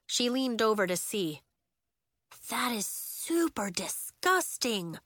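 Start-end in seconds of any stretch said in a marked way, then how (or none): 2.5-5.0 slow, disgusted drawl; grossed out. Acted. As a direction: let the disgust show. Nori grossed out.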